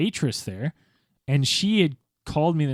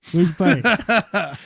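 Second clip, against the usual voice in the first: put-on slow, slurred drunk mumble, pitch up; 4 semitones higher, 4 dB louder, much slower than usual. Friend retelling his drunk self, slow and slurred. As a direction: play the wasted guy at the party.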